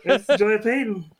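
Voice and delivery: cracking up mid-line; laughing. Acted. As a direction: break into laughter on it. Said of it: "horribly depressed".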